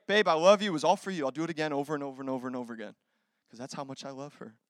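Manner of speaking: ordinary delivery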